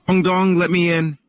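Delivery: high, coaxing wolf voice; wolf knocking. Acted. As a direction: knock and sweetly demand entry.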